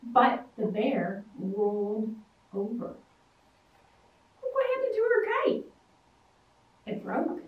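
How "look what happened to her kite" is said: plain reading voice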